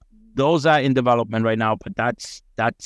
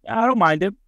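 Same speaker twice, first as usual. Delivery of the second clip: casual reply; 10 semitones higher than usual. Retold or imitated. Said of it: "I'm like".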